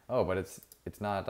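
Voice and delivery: ordinary talk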